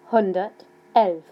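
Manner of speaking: slow dictation of Hundertelf; slow and clear. Shown as a demonstration. Teacher dictating a number slowly to students. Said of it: "I'll say them slowly".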